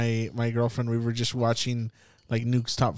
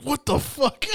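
much higher, startled what the fuck; shocked outburst; 12 semitones higher, slower than usual. Retold or imitated. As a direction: recoil at the face in the window. Shocked at stranger at door.

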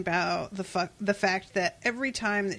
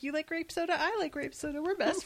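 put-on higher, softer, sweet kid voice; childlike voice; 7 semitones higher, 7 dB quieter, faster than usual. Fan imitating a child making friends, higher and softer. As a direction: say it like a shy little kid.

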